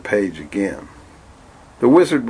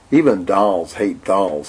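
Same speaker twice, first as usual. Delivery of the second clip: slow doll voice; slower than usual. Acted. Clyde the doll speaks slowly.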